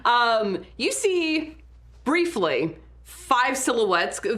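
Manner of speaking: ordinary delivery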